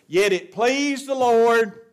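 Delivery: PLEASED stretched out high and loud; slow, ringing stress on "pleased"; emphatic scripture quote. Shown as a demonstration. Preacher making the church listen to the verse, stress on "pleased". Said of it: "listen to this word".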